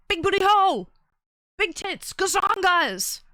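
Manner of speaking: high, loud mock catcall; imitated yelling. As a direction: yell crude heckles like a random stranger. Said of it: "like, yelled something about".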